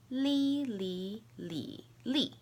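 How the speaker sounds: "Li, li, li, li" as high level, rising, dipping, falling; four tones in turn. Shown as a demonstration. Teacher showing the difference, "li" in four tones. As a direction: run "Li, li, li, li" through all four tones. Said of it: "I'll say the four tones".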